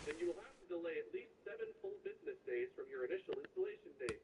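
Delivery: ordinary delivery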